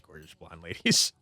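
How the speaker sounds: normal talking voice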